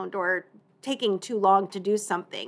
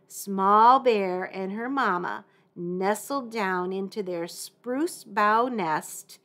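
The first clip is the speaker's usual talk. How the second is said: ordinary delivery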